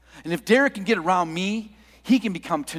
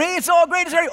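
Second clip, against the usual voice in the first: high, loud retold cheer; excited shout; 11 semitones higher, 6 dB louder, faster than usual. Workers thinking they got a breakthrough, excited shouting. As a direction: call out as if it finally worked.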